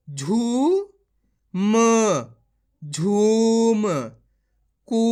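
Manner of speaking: ordinary delivery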